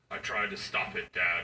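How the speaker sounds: low, solemn daughter impression; lightly voiced kid, pitched low; grave confession. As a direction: confess it in a dropped, mock-serious voice.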